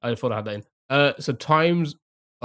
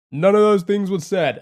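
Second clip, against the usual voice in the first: louder and higher insistent denial; loud protest; 6 semitones higher, 6 dB louder than usual. Real, not acted. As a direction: push back at chat, louder. Protesting chat's fake guesses.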